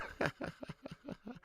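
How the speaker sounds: high, airy burst of cackling; gleeful laugh. Real, not acted. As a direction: laugh softly at rival fans' misery. Laughs at fans held back.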